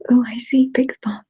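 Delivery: ordinary delivery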